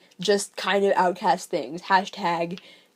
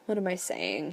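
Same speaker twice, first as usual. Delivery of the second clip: much softer, to himself; muttered; 2 semitones lower, 11 dB quieter than usual. Acted. Father muttering to himself mid-thought, very soft. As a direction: drop it under your breath. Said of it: "he muttered".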